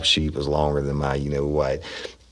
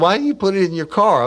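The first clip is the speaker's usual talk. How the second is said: high, louder put-on boss voice; imitating the boss; 6 dB louder than usual. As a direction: demand it in a squeaky boss voice. Boss Kearney voiced in story.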